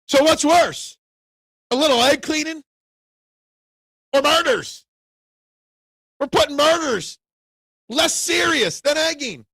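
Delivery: rising outrage, nearly shouting; indignant rant. Real, not acted. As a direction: hammer home how absurd it is. Heated rant about egging vs murders.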